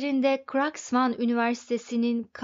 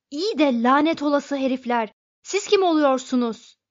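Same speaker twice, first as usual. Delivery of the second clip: loud angry challenge, rising higher; exasperated raised-voice outburst; furious cry; 3 semitones higher, 6 dB louder than usual. A narrator's reading. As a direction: demand angrily, building in pitch. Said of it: "diye haykırdı öfkeden kuduran Fogg".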